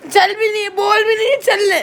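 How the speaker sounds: loud, upset burst; real heated outburst; angry shouting. Real, not acted. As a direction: shout it in exasperation. Shouts at family in quarrel.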